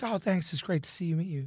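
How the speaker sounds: softly imitating cheerful cluelessness; feigned obliviousness. Line-cutter brushing off an angry man, feigned obliviousness. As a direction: play dumb, quietly polite, as if nothing is wrong. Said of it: "I'm just making believe I don't understand".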